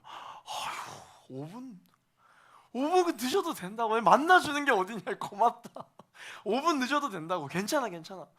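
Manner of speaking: put-on a smitten boyfriend forgiving his late girlfriend, gushing; gushing forgiveness. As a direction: brush it off, thrilled just to see her. Boyfriend forgives five minutes late.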